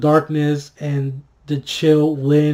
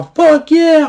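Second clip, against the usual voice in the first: triumphant cheer; 11 semitones higher, 7 dB louder than usual. Retold or imitated. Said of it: "like a".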